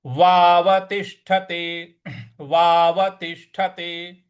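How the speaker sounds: वावतिष्ठते with gentle weight on ष्ठ, slow; light stress. Shown as a demonstration. Teacher showing light stress on वावतिष्ठते. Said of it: "उस पे हमको हल्का सा बल लगाना है".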